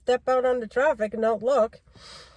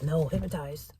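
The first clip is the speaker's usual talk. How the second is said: quiet reply; 7 semitones lower, 7 dB quieter than usual. Retold or imitated. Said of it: "I always say that".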